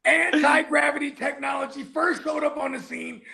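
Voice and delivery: high, shouted hype; excited shouting. Real, not acted. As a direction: hype it up for the clip. Joking excited podcast claim.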